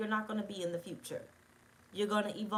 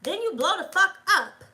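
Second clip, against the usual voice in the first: loud outburst; 10 semitones higher, 11 dB louder than usual. Real, not acted. Emphatic outburst to viewers.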